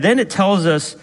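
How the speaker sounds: ordinary talk, nothing marked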